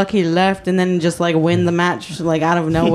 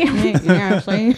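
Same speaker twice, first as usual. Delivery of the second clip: put-on old-timey voice; 2 semitones higher, much slower than usual. Old-timey voice bit continues.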